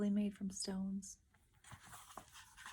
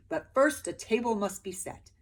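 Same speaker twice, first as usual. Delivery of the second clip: louder soldier order; soldier's command; 4 semitones higher, 8 dB louder than usual. A narrator's reading. Soldier commanding the peasants, loud. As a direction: order the table set. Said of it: "the soldier said".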